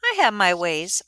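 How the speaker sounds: a touch of dark boasting in "I have my ways"; darkly. A narrator's reading. Ida hinting at her schemes, darkly. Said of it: "Ida adds darkly".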